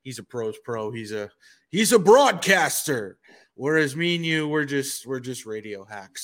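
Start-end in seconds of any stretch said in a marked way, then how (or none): 1.7-3.2 loud, swelling announcement; grand proclamation. Acted. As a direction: proclaim it loudly, like a title. Hails colleague as true broadcaster.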